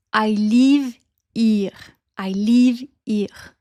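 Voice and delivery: French-accented LIVE as long, flat "leev"; slow French accent, HERE as "eer" without the H. Shown as a demonstration. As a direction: stretch the vowels like a French speaker. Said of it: "les voyelles sont un peu plus longues".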